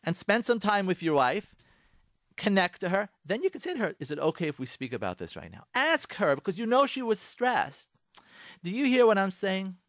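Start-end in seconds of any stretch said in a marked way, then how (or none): 4.0-5.6 lower, softer polite request; gentle asking. Shown as a demonstration. Husband softly asking his wife if now is okay. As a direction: ask softly for permission.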